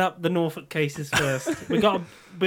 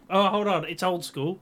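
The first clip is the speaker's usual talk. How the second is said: ordinary talk, nothing marked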